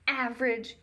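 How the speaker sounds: jeering. Acted. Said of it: "he jeered".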